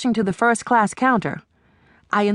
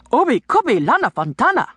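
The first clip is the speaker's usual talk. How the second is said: fast, higher shouted gibberish in a fake African accent; made-up African dialect; 5 semitones higher, faster than usual. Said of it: "I shout back in a made-up African dialect".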